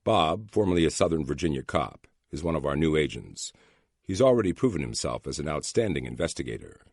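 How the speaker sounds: usual speaking voice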